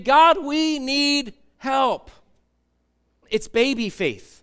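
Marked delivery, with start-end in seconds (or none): 0.0-2.1 loud, slow, high plea to God; desperate cry. Acted. Slaves in Egypt crying out to God: loud slow plea. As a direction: cry out for help, stretching every word.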